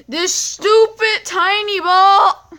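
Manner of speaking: high, loud furious yell at the game; real frustrated shriek; angry shout. Real, not acted. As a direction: scream at the stupid ball. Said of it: "This gets really mad".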